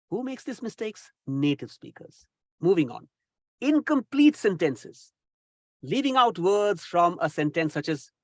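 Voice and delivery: ordinary talk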